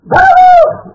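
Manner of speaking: high desperate shout for the rabbi; retold scream. Falling on the cliff, screaming for the rabbi, loud desperate cry. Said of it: "צרחתי".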